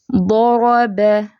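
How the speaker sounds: ضرب very slow, ض and ر heavy, ب light; heavy and light letters. Shown as a demonstration. Teacher demonstrating heavy letters to students, slow recitation. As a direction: draw out ضرب, heavy ض and ر, thin ب. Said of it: "ضاد और रा दोनों फैटे और बा बारीक".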